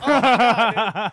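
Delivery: high, loud comic yell; acted-out startled wail; mock cry of shock. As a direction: cry out as if caught off guard. Acting out his shocked reaction.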